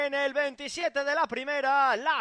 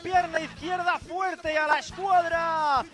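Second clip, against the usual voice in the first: breakneck goal commentary. Real, not acted. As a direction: race through the goal, nearly shouting. Commentator calls a stunning goal.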